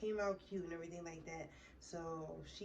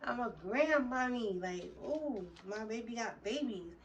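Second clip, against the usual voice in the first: higher, louder proud gushing; giddy proud gush, raised and loud; excited; 4 semitones higher, 5 dB louder than usual. Retold or imitated. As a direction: brag like a brand-new grandma. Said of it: "I'm so excited".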